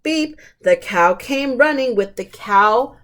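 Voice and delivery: usual speaking voice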